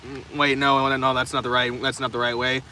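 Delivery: usual speaking voice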